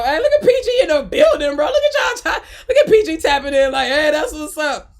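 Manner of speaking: high, loud, laughing hype; excited shout-out. Real, not acted. Streamer hailing viewers from PG, excited and laughing. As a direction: call out loudly through laughter.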